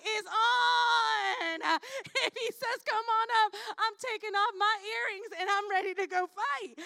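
high, loud, fired-up fighter voice; ready to jump in the ring; hyped up. Acted. Jonathan pumped to fight the enemy, hyped and loud. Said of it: "Jonathan kinda was hyped like that".

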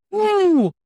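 high, drawn-out "woo"; a high celebratory woo let out mid-thought; excited whoop. Retold or imitated. Speaker recalls deciding to let their shine show, excited whoop. Excited exclamation in retold self-talk.

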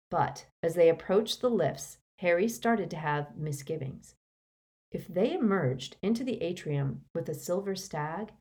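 plain reading voice

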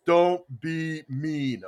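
drawn out slowly, word by word; slow, deliberate reading. Retold or imitated. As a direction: read it slow like big letters. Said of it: "in big letters".

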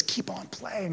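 very soft, unvoiced whisper. Jesus coming behind us to encourage, in a whisper. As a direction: drop right down to a whisper.

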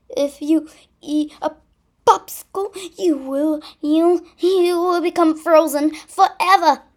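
high, loud dire warning; alarmed warning. Acted. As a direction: warn in panic. Scaredy's silly prediction.

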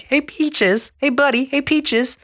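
very high, chirpy cartoon voice; goofy Heifer. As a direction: chirp it way up high like a clueless buddy. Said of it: "Heifer's just like".

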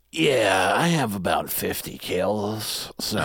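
slow, drawn-out imitation of a friend; Adam's voice. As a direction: put on a friend's voice, dragging out the boast. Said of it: "come in with that voice and go".

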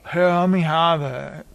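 lightly voiced drunk; a hint of slurred boozy demand; imitating a sloppy drunk; drunk man. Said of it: "He gets shit-faced".

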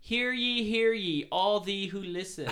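loud, high town crier proclamation; royal herald. Pretend. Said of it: "like, more, like, regal and, like, royal".

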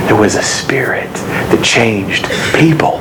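slow, low whisper. Real, not acted. Pastor whispers the key point to his congregation. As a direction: lean in and whisper it slowly like a secret. Pastor drops to secret-like emphasis.

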